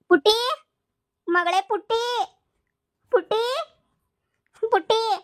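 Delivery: high, wailing call for a daughter; tearful calling out. Acted. Mother-in-law arrives calling for her daughter-in-law, tearful calling out. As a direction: call out high in feigned distress. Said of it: "ಇವ್ರು ಅಳ್ತಾ ಬರ್ತಿದಾರೆ".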